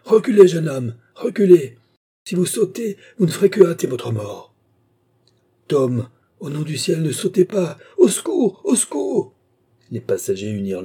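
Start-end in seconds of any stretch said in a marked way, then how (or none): 0.0-4.5 high, loud warning; menacing shout. A narrator's reading. Worker waving hammer warns young man not to jump, shouting. 5.7-9.3 very high, loud cries for help; plead, then scream for rescue; panicked plea. A narrator's reading. Mary begs Tom not to jump, panicked cries.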